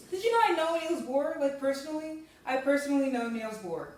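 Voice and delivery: loud, puffed-up bragging; indignant boast. Acted. Advisor boasting to his student, loud and indignant. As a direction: trumpet your credentials, offended.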